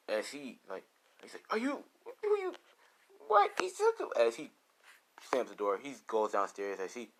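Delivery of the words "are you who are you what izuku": very high, stammering character voice; acted panicking girlfriend. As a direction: stammer after him in a squeaky high pitch. Rose panics as Izuku leaves.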